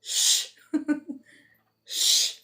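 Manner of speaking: a high giggling whispered "Shh"; laughing whispered shush. Real, not acted. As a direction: hush yourself through a giggle. Hushing herself while laughing.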